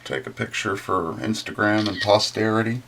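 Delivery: ordinary delivery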